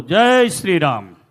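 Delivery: loud, drawn-out devotional cheer; big slow congregational shout; loud rallying cry. Real, not acted. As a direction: call the crowd into a chant, voice soaring then falling. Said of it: "જોરથી બોલો".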